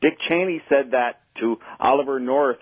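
normal talking voice

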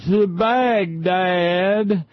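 high, slow, drawn-out yokel caricature; mock a ranting wing nut in a high put-on voice, stretching every word; hammed-up ignorant partisan hollering; screaming wing nut. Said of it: "wing nuts would scream".

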